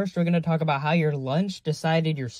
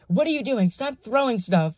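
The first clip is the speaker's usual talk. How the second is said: high-pitched mock telling-off; scolding principal; 7 semitones higher than usual. Principal to the friend after muffin hit, mock scolding. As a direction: imitate an indignant principal.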